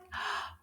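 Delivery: soft gasp of mock surprise; excited gasp. Teacher wonders what's in the bag, excited gasp. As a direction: breathe in sharply with wonder.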